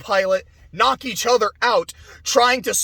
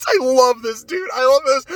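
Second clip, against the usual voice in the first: usual speaking voice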